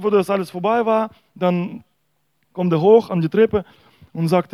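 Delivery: ordinary talk, nothing marked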